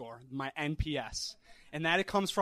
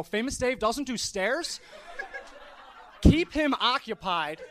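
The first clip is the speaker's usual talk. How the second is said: higher, slow words through real laughter; laughing disbelief; 8 semitones higher, slower than usual. Real, not acted. Disbelieving laugh at guest's demand.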